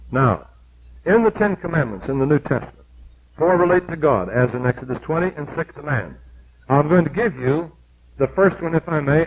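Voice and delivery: ordinary talk, nothing marked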